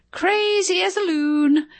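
Pamela mocking. A narrator's reading. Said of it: "Pamela said".

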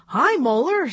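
lightly voiced puppeteer greeting her puppet; regular voice. A narrator's reading. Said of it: "in her regular voice".

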